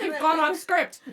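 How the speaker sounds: laughing through a quote. Retold or imitated. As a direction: giggle the words out.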